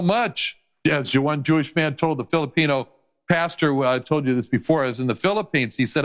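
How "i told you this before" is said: ordinary talk, nothing marked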